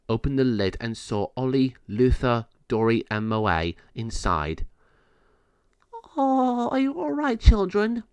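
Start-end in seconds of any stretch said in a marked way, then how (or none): 6.2-8.0 caring queen. Acted. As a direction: comfort the little ones. Queen comforts found kittens.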